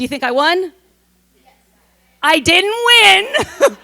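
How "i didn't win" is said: slow, higher, hammed-up crying; mock sob. Speaker tells the audience she lost, in a mock sob. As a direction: fake a comic sob over an old loss.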